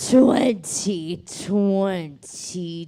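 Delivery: slow drawl. Pretend. Jokey drawn-out storytelling.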